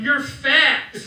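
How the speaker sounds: loud, high mock jeer; imitated taunt, pushed up and out; heckling kid yell. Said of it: "the kids are yelling at me".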